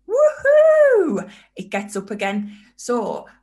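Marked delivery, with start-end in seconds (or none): 0.1-1.3 very high, loud joyful woohoo; excited whoop. Retold or imitated. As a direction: cheer like your spirit just leapt. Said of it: "your vibration just goes".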